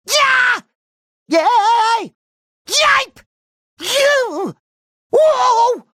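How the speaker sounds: short, very high cartoon yelps; excited whoops. Cartoon hero reacting to action, high excited yelps. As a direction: squeal each exclamation out high.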